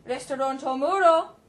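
loud voice down a phone line; faraway phone voice. Acted. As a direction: answer from far down the line. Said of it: "said a faraway voice".

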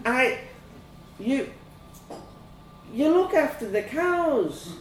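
put-on high, hesitant child; falter, guessing nervously; uncomfortable. A narrator's reading. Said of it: "I started to feel uncomfortable".

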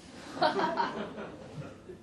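a breathy trailing laugh; airy laugh. Real, not acted. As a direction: laugh it off under your breath. Reader laughs after gore line.

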